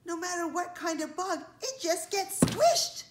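spider sounding the alarm, hitting the end hard; alarmed warning. A narrator's reading. Spider's dire warning to bugs.

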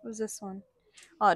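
ordinary talk

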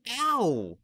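loud, very high "ow"; sudden high cry of pain; pained yelp. A narrator's reading. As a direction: yelp as the blow lands. Boy cuffed a second time.

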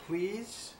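soft, small pleading question; lonely pumpkin begging softly; timid plea. A narrator's reading. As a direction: ask meekly, hoping. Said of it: "this time I said".

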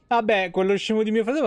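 usual speaking voice

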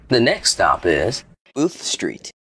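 normal talking voice

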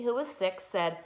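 ordinary delivery, nothing marked

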